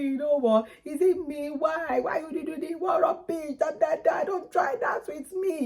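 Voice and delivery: high, shouting Yoruba woman quarrel; Yoruba accent. Retold or imitated. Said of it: "Excuse my Yoruba".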